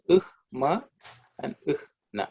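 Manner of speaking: "ugh ma" and "ugh na" with h before the nasal; "ugh ma" and "ugh na" in careful split syllables; technical Sanskrit pronunciation. Shown as a demonstration. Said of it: "From a technical Sanskrit rule perspective".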